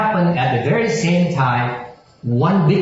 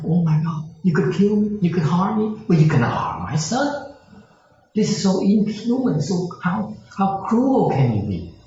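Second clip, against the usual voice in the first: heartbroken father; 7 semitones higher than usual. Retold or imitated. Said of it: "heart was all broken".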